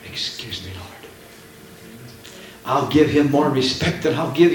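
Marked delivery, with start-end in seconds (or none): 0.0-0.9 soft, respectful aside to God; mild hushed apology; hushed polite excuse me. Retold or imitated. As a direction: quietly beg pardon after a yawn. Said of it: "I say".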